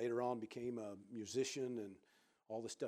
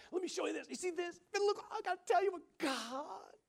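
much higher, louder, gushing host; excited imitation; 7 dB louder than usual. Jesse showing guests his house, imitated much higher and louder. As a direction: burst with childlike wonder.

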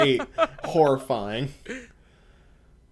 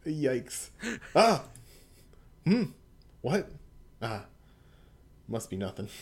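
ordinary delivery

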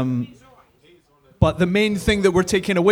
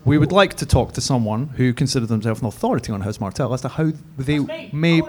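ordinary delivery

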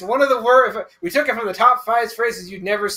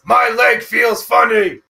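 cartoon quote voice; 6 dB louder, slower than usual. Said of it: "one we can quote".

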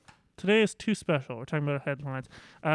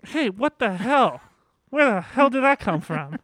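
mock outrage; 7 semitones higher, 6 dB louder, slower than usual. Mock outrage at weight jab.

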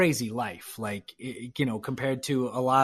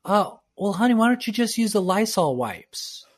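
raised, higher voice calling across the house; retold shout from afar; 5 semitones higher, 5 dB louder than usual. Partner calls from the other room, casual mock shout. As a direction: call it out breezily from the next room, a bit louder and higher. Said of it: "she shouted from the other room".

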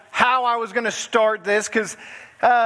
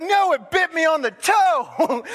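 higher, drawn-out mock protest; wife's worked-up phone voice, retold; imitating exasperated wife; 7 semitones higher, slower than usual. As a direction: play her indignant correction, stretched out. Retelling wife's snakebite phone call.

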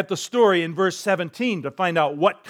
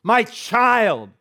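pleading father; 5 semitones higher, much slower than usual. Acted. Father pleading for his son.

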